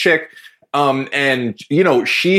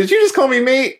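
surprised question; 10 semitones higher than usual. Retold or imitated. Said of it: "I was like".

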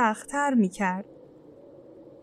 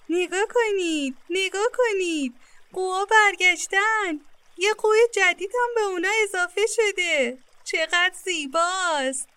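put-on higher, eager children's voices calling; 8 semitones higher than usual. A narrator's reading. Two children at the lake call out to each other, eager and high. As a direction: point and call out like excited kids. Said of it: "اونها با صدای بلند گفتن".

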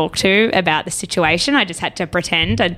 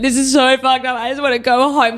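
higher-pitched mock wail; retold bawling; 5 semitones higher, 4 dB louder than usual. Girlfriend on their first night, mock bawling. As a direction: whine it as if bawling. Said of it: "I'm, like, bawling".

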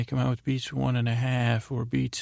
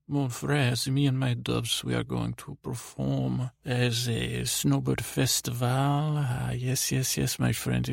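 hammed-up French accent, stretched endings; playful bad imitation of a French accent; mock French accent. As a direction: put on a fake French accent. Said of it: "we speak French, you don't".

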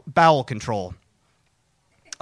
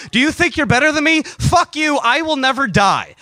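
loud, raised-pitch angry challenge; defiant outburst; 7 semitones higher, 3 dB louder than usual. Acted. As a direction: hurl it defiantly at the passersby. Defiant outburst at passersby while running.

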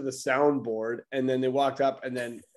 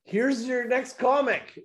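high, drawn-out mock announcer call; stretched-out, pitched-up stage intro; show host introduction; 9 semitones higher, slower than usual. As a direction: imitate a host bringing up the next act. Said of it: "and then they're like".

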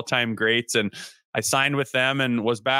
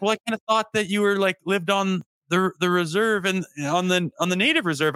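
higher, halting awkward explanation; imitating timid trainer; 7 semitones higher than usual. Player imitates trainer explaining his assumption, timid. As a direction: hedge your way through a sheepish admission. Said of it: "he's gonna be in timid".

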